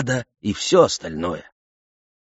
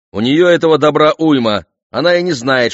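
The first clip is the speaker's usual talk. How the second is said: scornful dismissal; 5 semitones higher, 5 dB louder than usual. A narrator's reading. King scornfully dismisses the objection.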